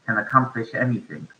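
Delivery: ordinary talk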